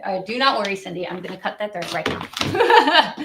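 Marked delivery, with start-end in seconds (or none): none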